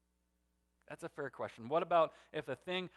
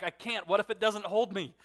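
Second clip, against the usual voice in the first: imitating a scared retort, getting louder; firing back in fear; 4 semitones higher, 6 dB louder than usual. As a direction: throw it back in mock panic, pitch up. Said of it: "she fires back".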